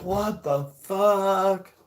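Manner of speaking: slow, drawn-out, high "What the fuck?"; stunned couple. Retold or imitated. Couple on the beach hit by joints, slow dazed disbelief. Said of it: "They're like".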